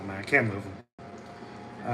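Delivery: usual speaking voice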